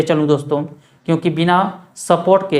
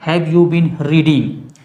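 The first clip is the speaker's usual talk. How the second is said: slow dictation; 2 semitones lower, much slower than usual. Shown as a demonstration. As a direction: Have you been reading said very slowly, word by word. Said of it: "क्या तुम दस बजे से पढ़ रहे हो?". (बहुत धीरे, शब्द-शब्द बोलकर)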